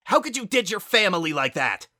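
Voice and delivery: high, loud furious scream. A narrator's reading. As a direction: scream it at her. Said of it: "Spitfire screamed".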